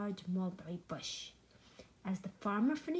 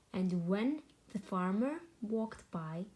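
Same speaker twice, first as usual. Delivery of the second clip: plain reading voice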